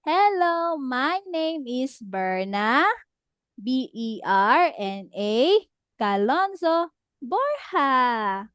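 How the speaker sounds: slow, clear model line with spelled letters; slowly, so the child can copy; slow model sentence. Shown as a demonstration. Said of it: "So we will spell it".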